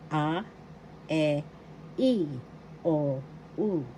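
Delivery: "A, e, i, o, u" each said as a short pure vowel; Tagalog vowels. Shown as a demonstration. Teacher modelling the Tagalog vowels, pure short vowels. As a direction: sound out each vowel cleanly, a e i o u. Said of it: "That's how it is pronounced".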